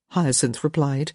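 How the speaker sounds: usual speaking voice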